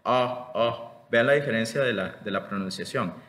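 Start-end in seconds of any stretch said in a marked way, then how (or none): 0.0-0.9 "A" as in option, an ah sound; contrasting A. Shown as a demonstration. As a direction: say "A" as the back ah of option. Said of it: "y esta otra es".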